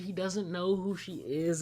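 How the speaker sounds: usual speaking voice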